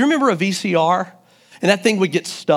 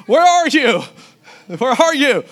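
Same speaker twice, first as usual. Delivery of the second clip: mock frantic search; 8 semitones higher, 4 dB louder than usual. Mock search for toilet paper.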